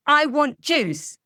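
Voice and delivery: put-on slow, loud, high demanding child. Child rudely demanding juice from parent, whiny and blunt. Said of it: "Our child says".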